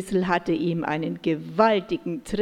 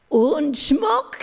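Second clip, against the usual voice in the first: higher, louder magpie caw; croaking magpie; 5 semitones higher, 6 dB louder than usual. A narrator's reading. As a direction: squawk it like a greedy magpie. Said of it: "krächzte die Elster".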